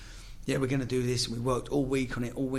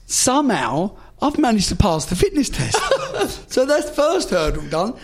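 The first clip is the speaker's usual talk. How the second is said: higher and louder, words breaking into laughter; can't get through it without cracking up; laughing through it; 9 semitones higher, 7 dB louder than usual. Real, not acted. Guest cracking up retelling the suppository fitness test, laughing. Laughing at his own story.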